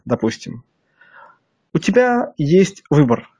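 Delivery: ordinary talk, nothing marked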